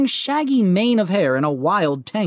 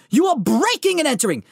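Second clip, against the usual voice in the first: pompous demand; 9 semitones higher than usual. Acted. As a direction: order the intruder out. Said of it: "he said".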